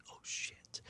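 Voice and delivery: whispered dismay under breath; a shocked voiceless whisper. Retold or imitated. Realizing the reveal is now, whispered oh no.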